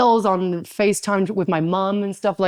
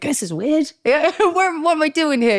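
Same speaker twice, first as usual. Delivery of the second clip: high, nervous-laugh bewilderment; mock bewildered, half laughing; weirded out; 8 semitones higher than usual. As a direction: retell being lightly thrown, pitched up. Retold bewilderment in locker room.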